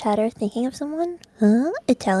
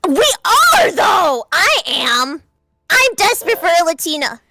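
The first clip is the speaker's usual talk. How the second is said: high, louder, shouting it out; loud admission; 5 semitones higher, 5 dB louder than usual. Real, not acted. Shouting back at the desperation joke. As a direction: own it with a shout.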